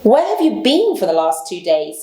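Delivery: usual speaking voice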